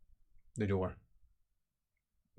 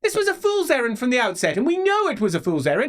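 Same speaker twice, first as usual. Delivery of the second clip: high, loud, fast angry shouting; exasperated nephew yelling; furious outburst; 8 dB louder than usual. Acted. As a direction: explode at her in disbelief. Nephew rages at the fool's errand.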